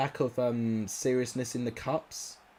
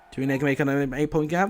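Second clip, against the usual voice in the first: normal talking voice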